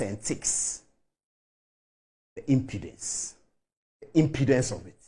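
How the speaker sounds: low, hushed and disgusted; scornful hush. Real, not acted. A pundit quietly disgusted at Anas. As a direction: breathe out the contempt quietly.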